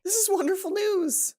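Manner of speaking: high, slow, beaming exclamation; overjoyed. Acted. John gushing to Hana over the news, high and overjoyed. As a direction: draw out the joy, high and bright.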